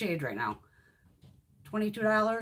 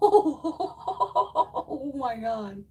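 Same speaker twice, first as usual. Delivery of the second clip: high, louder, very slow laughing oh my God; laughing amazement; 9 semitones higher, 6 dB louder, much slower than usual. Real, not acted. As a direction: laugh it out in delight. Laughing at the swatch.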